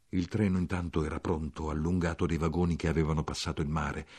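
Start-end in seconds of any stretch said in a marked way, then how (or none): none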